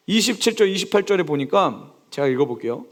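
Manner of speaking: ordinary talk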